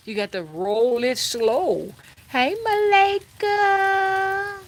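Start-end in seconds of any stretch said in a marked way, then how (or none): 2.3-4.6 high, drawn-out hello; sing-song greeting. Real, not acted. Streamer greets Malika in the chat, high sing-song. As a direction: stretch the name high and slow like a little tune.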